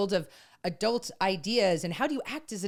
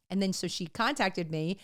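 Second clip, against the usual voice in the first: ordinary talk, nothing marked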